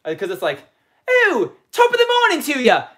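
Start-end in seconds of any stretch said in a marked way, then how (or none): 1.3-2.8 high, sliding fake brogue that slips English; botched Irish accent; failed Irish accent. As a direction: try Irish and land in English. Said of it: "Nope, that's going English".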